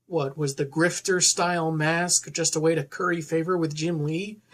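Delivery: usual speaking voice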